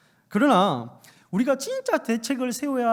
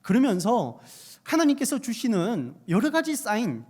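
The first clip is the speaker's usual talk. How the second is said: ordinary delivery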